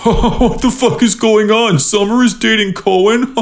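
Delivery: stunned onlookers, half laughing; gossiping crowd in mock shock. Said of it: "people are like".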